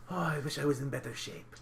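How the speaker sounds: soft, winded companion voice; out-of-breath Nicholas. Acted. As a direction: act lightly out of breath. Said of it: "he's really out of breath".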